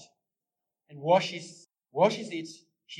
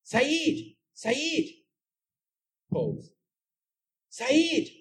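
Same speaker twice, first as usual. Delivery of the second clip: much higher, louder calling out; yelling a name; 12 semitones higher, 6 dB louder, much slower than usual. Acted. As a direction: call across the yard. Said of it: "and yells".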